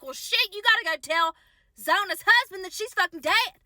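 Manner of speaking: exasperated mom. Retold or imitated. Mom reacts to news of death.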